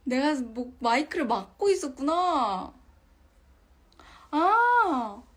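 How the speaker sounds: loud, slow, amazed exclaim; loud exclamation. Real, not acted. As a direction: call out, amazed at the mistake. Realizes mic was blocked.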